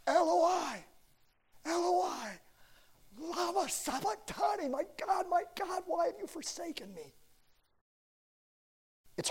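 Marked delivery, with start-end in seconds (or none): 0.1-7.1 high, wailing cry of desperation; a forsaken man's desperate plea, sung out high; anguished cry. Retold or imitated. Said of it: "when he cries out".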